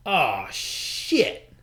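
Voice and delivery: drawn-out, sinking dismay; dismayed groan. Retold or imitated. Runner spotting trouble with his car, dismayed. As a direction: let the bad news land slowly.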